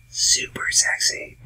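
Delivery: slow, soft whisper; sultry whisper. Real, not acted. Host on Irish accents on ladies, sultry whisper. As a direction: whisper it slowly for emphasis.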